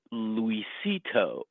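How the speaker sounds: very slow, stretched-out Luisito, each syllable held; drawn-out name. Retold or imitated. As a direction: linger on Luisito like savouring it. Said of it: "like that".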